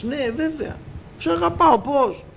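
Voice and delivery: high, eager protest, rising on σε αγαπάω; eager Peter. Acted. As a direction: Peter bursting out that of course he loves him. Peter answers the Lord eagerly.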